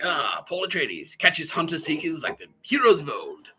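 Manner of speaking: slow, high mock-epic narration; grand narrator quote. Quoting the film line in a slow, mock-epic voice.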